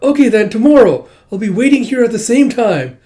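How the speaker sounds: high, loud, acted excitement; eager agreement. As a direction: set the date eagerly. Girl delighted, arranges next meeting.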